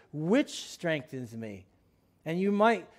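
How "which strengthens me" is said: "Which strengthens me." said slowly and lower, stressing "Which"; correct word. Shown as a demonstration. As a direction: lean on "Which" to correct the quote. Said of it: "in my Bible, it says".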